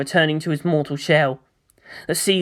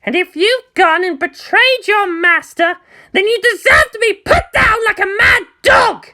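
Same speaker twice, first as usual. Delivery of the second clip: much higher, louder contemptuous shout; spat; 4 dB louder than usual. Acted. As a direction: spit the threat at the traitor. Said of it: "Asuka spat".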